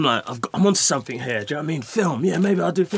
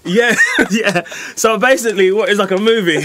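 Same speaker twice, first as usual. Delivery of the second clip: talking through laughter, louder; laughing; 4 semitones higher, 5 dB louder than usual. Real, not acted. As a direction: laugh it out while telling. Laughing recalling crash.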